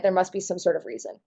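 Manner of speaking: ordinary delivery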